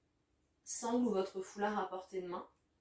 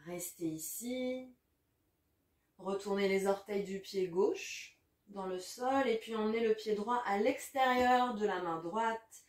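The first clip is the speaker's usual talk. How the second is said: ordinary delivery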